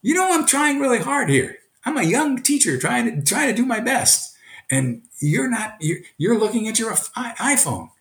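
high-pitched, pleading reproach; acted-out hurt, earnest complaint; earnest teacher appeal. Shown as a demonstration. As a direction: play a young teacher appealing to a distracted student. Voices young teacher appealing to student.

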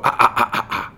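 sharp breathy ah-ah-ah to stop a dog; corrective ah-ah. Shown as a demonstration. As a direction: cut the dog off with quick ah sounds. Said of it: "before I'll go".

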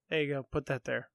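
ordinary talk, nothing marked

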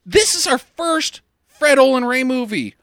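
ordinary talk, nothing marked

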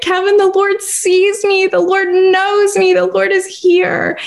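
high, drawn-out cry of being overcome; retold tearful outburst. Telling Kevin in the car while bawling, high and overcome. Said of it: "and I was bawling".

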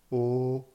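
O held high and level; first tone. Shown as a demonstration. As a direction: sustain a flat, high "O". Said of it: "Avec le son O".

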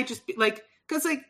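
usual speaking voice

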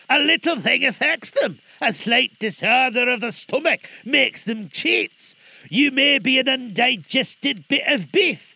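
put-on high, loud, nervous old miser; agitated old Scrooge. Scrooge jokes to hide terror.

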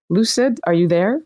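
ordinary talk, nothing marked

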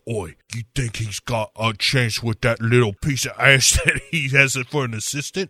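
put-on cockney girl gossip; mock cockney. Spice Girls gossip.

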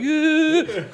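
squeaky high patriotic yeah; high mock cheer. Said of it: "waving the American flag".

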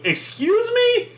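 high, slow incredulous "excuse me"; drawn-out offended old woman; mock outraged customer; indignant old lady.

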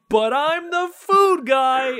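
high, slow, drawn-out declaration; food guy catchphrase. Retold or imitated. Podcaster imagining the episode ending, high and drawn out. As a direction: deliver it like a proud tagline. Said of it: "he would just be like".

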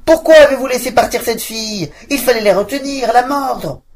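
high, loud furious yell; screaming witch whipping her dogs. Acted. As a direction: howl at the dogs. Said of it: "en hurlant".

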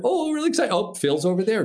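re-enacted giddy outburst; giddy excitement. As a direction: act overexcited, then catch yourself. Excited on seeing the helicopter.

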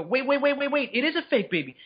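high, quick retold double take; startled realization. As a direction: re-enact sudden disbelief, fast and high. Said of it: "And then two more seconds later".

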